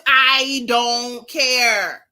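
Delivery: slow, stretched-out I don't care; drawn out. Real, not acted. Ranting vlogger stretching out her refusal, slow. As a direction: drag every word out in disgust.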